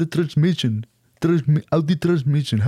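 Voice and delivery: slow mock English, drawn out; hamming up a clumsy English; fake English. Imitando inglés ante los árabes. (inglés exagerado y lento)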